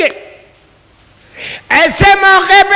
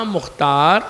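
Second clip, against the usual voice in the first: same gentle tone; 10 semitones lower, 6 dB quieter than usual. A narrator's reading. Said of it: "اسی tone میں، اسی آواز میں کہا".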